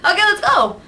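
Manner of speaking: high, loud breezy okay let's go. Retold or imitated. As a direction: chirp it out carefree. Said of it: "I can't just be like".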